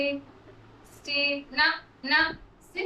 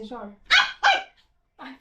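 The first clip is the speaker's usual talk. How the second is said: ordinary delivery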